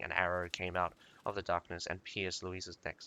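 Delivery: ordinary delivery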